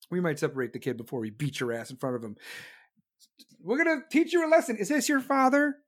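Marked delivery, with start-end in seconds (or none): 3.6-5.8 put-on talking to a child. Acts out addressing the kid.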